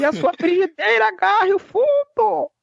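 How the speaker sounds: mock preacher curse.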